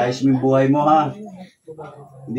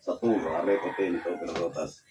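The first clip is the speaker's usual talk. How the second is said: softer, rapid Latin prayer chant; murmured incantation; 2 semitones lower, 7 dB quieter than usual. Acted. Faith healer murmuring prayers and commands to dissolve the illness.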